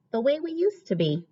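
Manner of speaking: usual speaking voice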